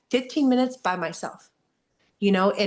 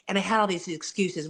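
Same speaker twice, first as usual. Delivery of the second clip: ordinary delivery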